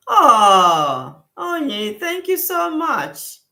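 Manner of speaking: high, squealing thank-you, drawn out; delighted thanks. Real, not acted. Host thanking a viewer for a gift in the chat, squealing with delight. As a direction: gush with surprised joy at the gift.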